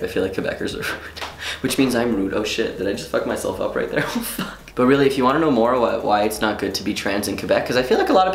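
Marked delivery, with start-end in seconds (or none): none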